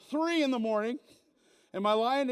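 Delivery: ordinary talk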